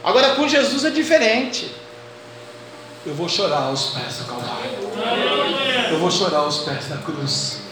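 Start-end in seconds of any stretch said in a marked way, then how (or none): 3.0-7.6 tender preaching. Acted. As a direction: sink into quiet awe. Preaching, drops low and soft.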